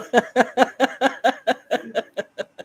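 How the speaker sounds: long, high-pitched laughing fit; helpless laughter. Real, not acted. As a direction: break down laughing mid-sentence. Cracks up mid-sentence.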